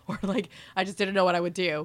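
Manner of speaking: ordinary talk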